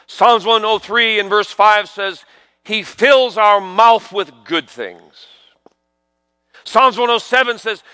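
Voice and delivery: high, emphatic pulpit preaching; fervent preaching. Acted. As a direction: build to a ringing proclamation with punched phrases. Preaches from scripture.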